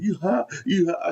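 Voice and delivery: high-pitched teasing question; mocking taunt. Storyteller mimics high friends teasing him, mocking high taunt. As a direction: mimic friends needling you, pitched right up.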